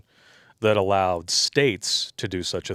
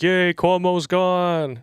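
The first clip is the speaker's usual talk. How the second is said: high, slow, mocking cheer; hammed-up fake celebration, pitched up and stretched; mock cheering crowd; 9 semitones higher, 5 dB louder, much slower than usual. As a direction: send up the happy crowd with a high drawn-out yay. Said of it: "everybody was like".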